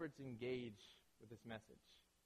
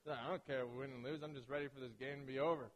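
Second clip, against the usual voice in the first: apathetic; 2 semitones lower than usual. Retold or imitated. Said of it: "She's apathetic".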